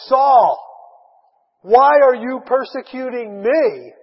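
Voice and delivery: booming call from heaven, drawn out slowly; voice of the Lord. Acted. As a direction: call him out loud, like God from above. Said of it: "it's the voice of the Lord".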